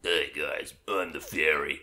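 fairy voice. Acted. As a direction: introduce yourself as a tiny fairy. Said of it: "my Ocarina of Time fairy voice".

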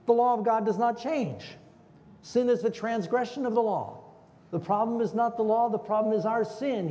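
rising pulpit proclamation; preaching at full force. Acted. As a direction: hammer home the point from the pulpit. Preacher builds to a peak.

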